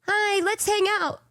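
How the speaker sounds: high-pitched little robot companion; cute high voice asking to play; Michi. Retold or imitated. Robot pipes up as she lies in bed, high cheerful invitation.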